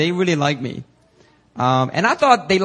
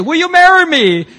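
mock proposal; 12 semitones higher, 9 dB louder than usual. Mock American proposal scene.

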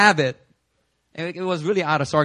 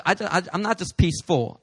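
normal talking voice

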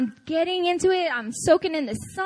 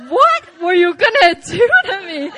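loud, laughing indignant question; laughing mock outrage; 5 semitones higher, 11 dB louder than usual. Preacher retelling retort to stranger, laughing outrage. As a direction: throw it back at him half laughing.

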